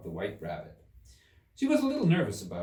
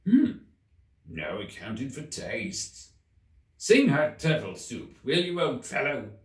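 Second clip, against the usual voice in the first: rather offended; 3 semitones higher, 4 dB louder than usual. Acted. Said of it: "in a rather offended tone".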